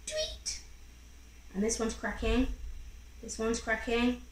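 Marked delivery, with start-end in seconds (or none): 0.1-0.6 very high tiny tweet; baby bird tweet. Acted. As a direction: chirp like a newborn bird.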